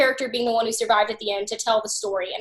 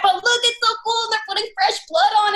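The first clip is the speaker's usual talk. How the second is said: put-on excited kid; 9 semitones higher, faster than usual. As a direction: gush about the fake gore like a delighted kid. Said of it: "And I'm like".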